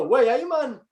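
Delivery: a hammed-up Geordie yell from the crowd; imitating a Geordie shout. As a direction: call out like a Geordie fan in the stands. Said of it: "this Geordie accent shouting".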